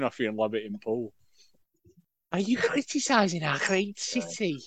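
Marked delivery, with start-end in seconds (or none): 2.3-4.6 higher, slow, offended Scouse; indignant Scouser. Pretend. Host imagines a Scouser in a hammock confronting his friend, mock indignant Scouse. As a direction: puff up like a Scouser defending his city. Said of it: "the Scouser in Hammock behind you now".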